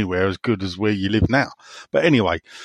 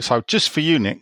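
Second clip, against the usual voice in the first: normal talking voice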